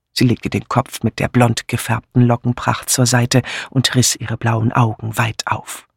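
ordinary delivery, nothing marked

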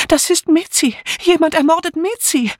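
high-pitched frightened outcry; alarmed cry. A narrator's reading. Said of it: "schluchzte, rief nun".

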